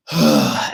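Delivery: a long audible sigh out through the nose; loud sighing exhale. Shown as a demonstration. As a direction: let the breath go in one big sigh. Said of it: "just more of a sigh".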